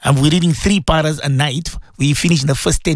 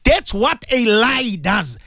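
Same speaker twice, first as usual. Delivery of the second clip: ordinary delivery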